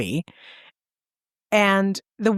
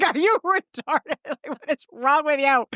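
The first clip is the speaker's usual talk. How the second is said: exasperated outburst; 7 semitones higher than usual. Retold or imitated. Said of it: "And I'm like".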